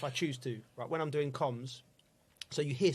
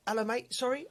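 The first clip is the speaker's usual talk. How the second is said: mock innocent; 8 semitones higher than usual. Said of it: "I'm going".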